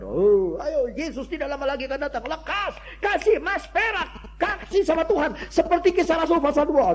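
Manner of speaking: high-pitched mock exhortation; hammed-up preacher pressing the flock, high; imitated urging preacher. False preacher urging followers to give gold to God, mock urgent exhortation.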